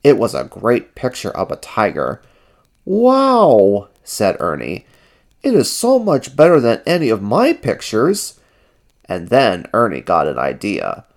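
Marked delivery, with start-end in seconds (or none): 2.9-3.9 high, amazed exclamation; high character voice full of wonder; Ernie's voice. A narrator's reading. Ernie admires the tiger. 5.4-8.4 high, admiring character voice; high-pitched wonder; Ernie's voice. A narrator's reading. Ernie admires cousin's picture.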